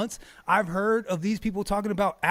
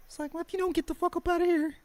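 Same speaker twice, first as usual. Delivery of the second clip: high, fast mocking voice; mock high taunt; 11 semitones higher, faster than usual. Streamer mocking online critics in a high voice. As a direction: squeak it out as a sneering taunt.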